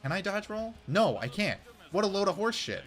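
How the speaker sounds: normal talking voice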